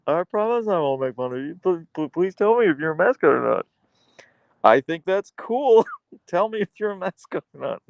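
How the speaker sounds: high-pitched, laughing through the plea; giggly pleading. Real, not acted. Streamer begging a viewer to admit being a mascot, laughing plea.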